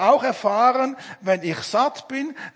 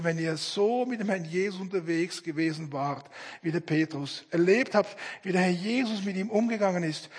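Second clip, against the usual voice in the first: ordinary delivery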